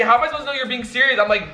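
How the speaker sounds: ordinary delivery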